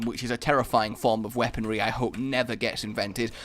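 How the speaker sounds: ordinary talk, nothing marked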